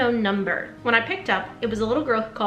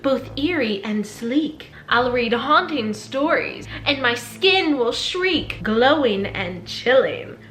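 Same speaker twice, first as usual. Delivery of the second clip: slow, theatrical horror-host recital; slow haunted-house host reciting a rhyme; spooky host intro; slower than usual. Acted. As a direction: ham up a creepy rhyming welcome, slowly. Spooky rhyming show intro.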